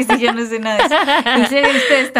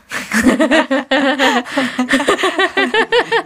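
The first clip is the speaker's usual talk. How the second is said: laughing; 5 semitones higher, 4 dB louder than usual. Real, not acted. Risa de las dos.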